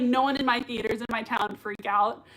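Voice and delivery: ordinary talk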